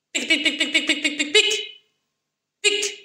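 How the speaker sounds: very high squeaky pics; squeaky squirrel pic. A narrator's reading. Storyteller squeaking for the squirrel.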